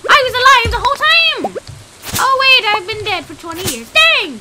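put-on high, cackling old grandpa voice; high-pitched, hammed-up grandpa reveal; gleeful grandpa. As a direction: crow triumphantly like a treasure-hiding grandpa. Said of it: "It's like my grandpa secretly sent me".

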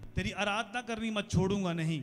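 ordinary talk, nothing marked

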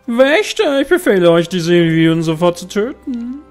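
commanding villain voice, high and loud; evil queen. Acted. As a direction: bark orders like a cruel noble. Noble commands guards in cutscene.

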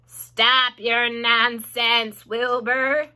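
slow, loud, drawn-out reprimand; stern old sheep, dragging the words out; disgusted scolding. A narrator's reading. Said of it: "looked at each other in disgust".

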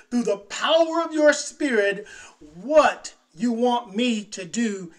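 loud, high, pleading prayer; fervent prayer. Pretend. Preacher models a prayer to God, loud fervent plea. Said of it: "go with the intent".

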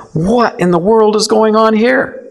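high, astonished exclaim; shocked outsider reading gospel; bewildered wow; amazed. Retold or imitated. Amazed first-time reader of gospels.

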